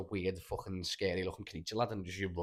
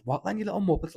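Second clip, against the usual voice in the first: higher, louder cocky taunt; mocking dream kid; 10 semitones higher, 6 dB louder than usual. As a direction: taunt them like a brash kid, pitched up. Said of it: "this kid comes out of nowhere and goes".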